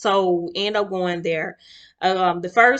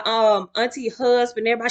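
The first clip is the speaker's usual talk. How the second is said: ordinary delivery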